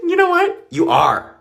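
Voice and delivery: high, biting retort; sassy comeback. Retold or imitated. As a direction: snap back with sass. Blunt comeback to girl.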